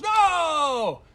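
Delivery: loud high groaning no; mock crowd wail; crowd cry. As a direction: shout in dismay. Hot tub crowd crying out.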